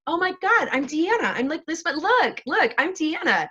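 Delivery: mock defensive. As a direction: act out denying it all.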